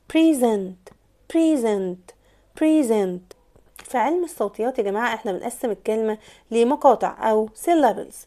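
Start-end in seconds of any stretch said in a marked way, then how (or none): 0.1-3.4 "present" repeated as pri-ZENT, evenly spaced; stress on second syllable. Shown as a demonstration. Teacher drilling the verb's pronunciation, "present" as pri-ZENT. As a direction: model the verb "present", weight on ZENT. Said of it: "present كفيرب نطقها".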